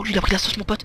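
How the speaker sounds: usual speaking voice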